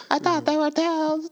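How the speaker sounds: very high, hammed-up dread; mock horror. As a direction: wail it like a doomed legend's victim. Mock horror at a blinding legend.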